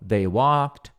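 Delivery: very slow model sentence for students; slowly, word by word, so they can repeat; slow repeat-after-me. Shown as a demonstration. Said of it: "Você pode até repetir comigo".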